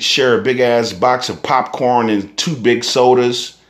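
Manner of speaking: normal talking voice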